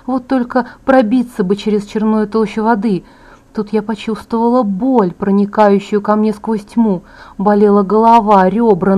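usual speaking voice

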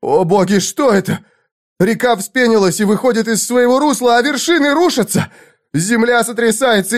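high, climbing panic; shrieking. A narrator's reading. Squire watches earth and cliffs collapse, shrieking in alarm. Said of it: "взвизгнул Сквайр".

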